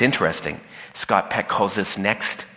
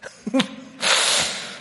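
airy giggle. Real, not acted. Breathy laugh after joke.

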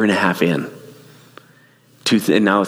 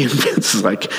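ordinary talk

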